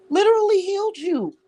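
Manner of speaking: high emphatic stress on literally; emphatic insistence. Real, not acted. Insisting to Mike she healed him, high emphatic. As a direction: hammer home that you healed him.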